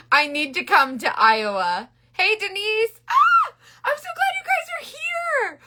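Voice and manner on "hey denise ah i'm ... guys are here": very high, drawn-out squealing welcome; thrilled high-pitched greeting; excited squeal. Real, not acted. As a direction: squeal with delight at friends arriving. Squealed greeting to arriving viewers.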